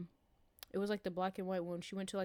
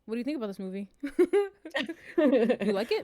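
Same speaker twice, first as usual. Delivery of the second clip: loud teasing mom imitation, laughing; 3 semitones higher, 9 dB louder than usual. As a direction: tease like a knowing mother. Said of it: "she was like this".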